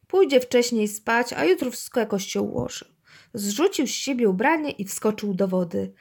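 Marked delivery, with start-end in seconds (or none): none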